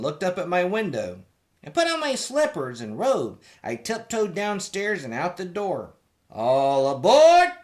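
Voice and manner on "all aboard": loud, slow call of "All aboard". A narrator's reading. Conductor calling the child aboard, loud and drawn out. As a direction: boom it down the platform. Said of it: "the conductor cried out".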